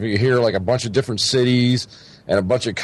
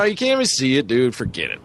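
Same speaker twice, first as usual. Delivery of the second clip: normal talking voice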